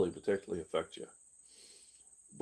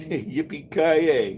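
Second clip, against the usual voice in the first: slow, drawn-out gleeful cheer with a laugh; cowboy whoop; 5 semitones higher, 7 dB louder, much slower than usual. Pretend. Celebrating a pretend win.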